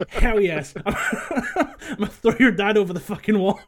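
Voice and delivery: gleeful laughing delight. Retold or imitated. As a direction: laugh through the words. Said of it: "I'm like".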